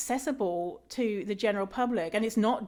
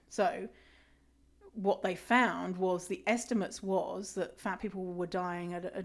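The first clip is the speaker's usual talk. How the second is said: ordinary talk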